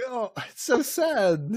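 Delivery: high, slow hammed-up lament; mourning the knocked-over gong; mock sorrow. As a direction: fake grief for a gong.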